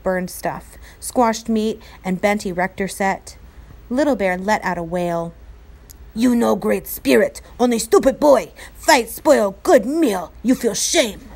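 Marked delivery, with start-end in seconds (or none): 6.2-11.3 angry warrior voice. A narrator's reading. Little Bear scolding boys.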